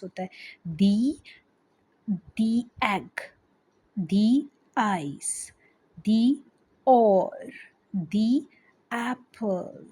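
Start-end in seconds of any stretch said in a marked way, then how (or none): 2.1-7.5 "the" said as dee before egg, eyes and oar. Shown as a demonstration. Teacher shows her class the dee sound before vowels, said carefully. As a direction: stretch "the" into a clear dee before each vowel word. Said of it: "दी कहाँ pronounce होता है".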